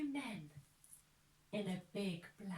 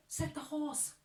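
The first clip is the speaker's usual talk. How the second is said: ordinary delivery, nothing marked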